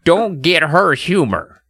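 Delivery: high, slow character voice; slow, high put-on woman's voice; neighbour lady. A narrator's reading. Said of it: "Missus Miller said".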